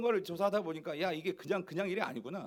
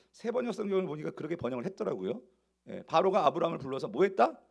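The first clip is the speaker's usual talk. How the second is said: usual speaking voice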